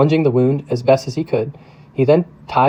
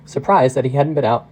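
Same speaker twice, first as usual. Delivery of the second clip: plain reading voice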